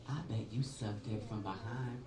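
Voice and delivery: soft slow sneer; low-key parting jab, said slow; snide quiet insult. Retold or imitated. Comic voicing a woman's parting insult through the train door, slow sneer. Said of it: "and she goes".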